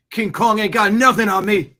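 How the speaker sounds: loud, high, swaggering movie boast; Denzel impression. Host quoting a film clip, loud boastful imitation. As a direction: roar it like a cocky movie star. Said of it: "I was just watching a Denzel clip".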